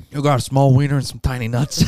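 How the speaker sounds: loud and high, laughing through it; mock insult, loud. Pistachio taunts the waiter, loud and laughing.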